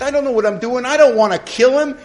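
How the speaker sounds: high, loud panicked-sounding call; retold yell. Sponsee calling after his departing sponsor, mock yelling. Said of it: "I yelled to the back of his bald head".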